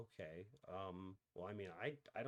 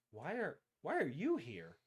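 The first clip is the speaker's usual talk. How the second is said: shopkeeper asks; 7 semitones higher, 8 dB louder than usual. Acted. GM voices suspicious shopkeeper.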